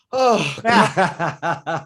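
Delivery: delighted laughing outburst. Real, not acted. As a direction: exclaim through laughter. Host laughing at shared feeling.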